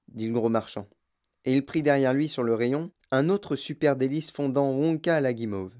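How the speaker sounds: plain reading voice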